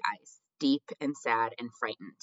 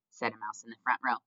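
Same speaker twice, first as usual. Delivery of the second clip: ordinary delivery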